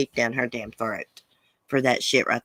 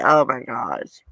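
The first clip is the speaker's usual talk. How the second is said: ordinary delivery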